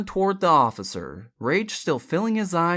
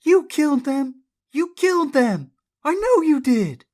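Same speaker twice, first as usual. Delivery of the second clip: high, loud frantic accusation; hysterical scream; 9 semitones higher, 7 dB louder than usual. A narrator's reading. Jessie screams at Bobby, hysterical. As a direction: cry it out hysterically. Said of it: "Jessie screamed hysterically".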